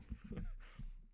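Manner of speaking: short airy laugh; amused chuckle. Real, not acted. Storyteller amused by the baffled boss, short laugh. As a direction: laugh at your own impression.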